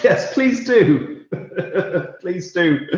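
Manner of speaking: high, amused plea through laughter; laughing delight. Real, not acted. Answering a viewer's disco joke, laughing delight. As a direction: egg them on, laughing.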